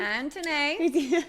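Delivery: drawn-out loud call for Anthony. Pretend. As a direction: call his name across the house. Calling out for Anthony.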